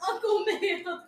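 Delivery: high, helpless laughing voice; cracking up. Real, not acted. Co-host repeating a silly nickname, cracking up. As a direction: squeeze the name out through laughter.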